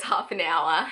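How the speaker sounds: ordinary talk, nothing marked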